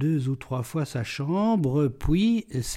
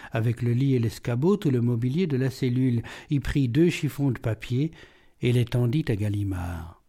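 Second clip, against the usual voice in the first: plain reading voice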